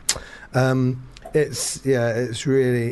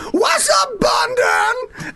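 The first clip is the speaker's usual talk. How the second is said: mangled London; 5 dB louder, much slower than usual. Retold or imitated. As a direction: holler it like a frontman. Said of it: "And he go, go, going".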